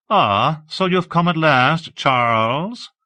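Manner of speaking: an unhurried, high society voice; Hawke, languid greeting. Acted. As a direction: greet him like a lazy aristocrat.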